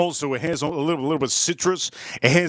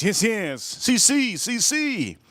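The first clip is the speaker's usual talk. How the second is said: high, repeated eager confirmations; eager local guide; 7 semitones higher, slower than usual. Retold or imitated. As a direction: play the stranger confirming in a rising high voice. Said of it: "He goes".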